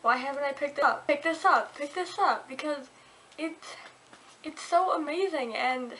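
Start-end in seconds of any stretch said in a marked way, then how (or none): 0.0-2.5 acted-out bookworm urgency; excited imagined reader. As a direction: rush it out, pleading to the reader. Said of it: "you'll be like".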